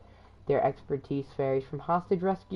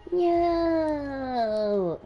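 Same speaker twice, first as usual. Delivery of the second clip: high, drawn-out wail of protest; long childish mock-dismayed cry; whiny no; 11 semitones higher than usual. Dismay that Ash is female.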